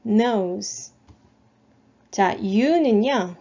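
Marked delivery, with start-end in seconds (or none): 0.1-0.9 "nose." with a long oh, final E silent. Shown as a demonstration. Teacher repeating the word for phonics learners, long O and silent E. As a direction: model "nose." slowly as one syllable. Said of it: "O 발음 역시 뒤에 E는".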